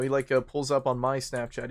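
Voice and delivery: ordinary delivery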